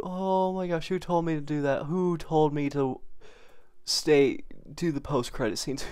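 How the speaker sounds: ordinary talk, nothing marked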